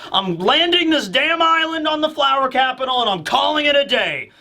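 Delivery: high-pitched, hammed-up villain tirade; acted angry demands, pitched well up; ranting tyrant. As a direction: bellow orders like a fed-up warlord.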